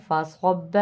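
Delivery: فصب with a thick ص joined into the ب; heavy sad. Shown as a demonstration. Teacher showing the heavy sad to students. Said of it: "با کے ساتھ ملا کے موٹا پڑھیں گے".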